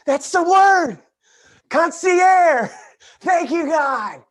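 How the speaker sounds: high, loud exclaiming; triumphant outburst. Real, not acted. Host finally remembers the word, triumphant shout. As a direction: shout it as a sudden find. Suddenly finds the word he wanted.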